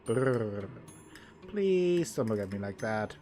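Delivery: usual speaking voice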